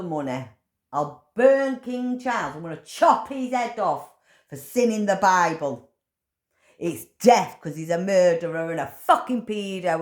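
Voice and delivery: high, loud furious ranting; shouting down the king in rising fury; angry rant. Real, not acted. Ranting to the camera about King Charles, high and loud with fury. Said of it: "I've had my little rant".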